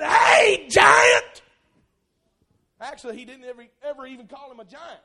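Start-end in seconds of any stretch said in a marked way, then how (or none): 0.0-1.4 high, loud shout across the valley; calling out. Retold or imitated. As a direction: holler it at the giant. David calling out to Goliath.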